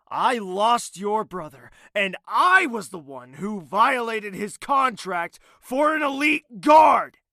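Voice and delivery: sarcastic confession building to a yell; building to a shout. Acted. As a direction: let it boil over into a shout. Villain snaps, ends shouting.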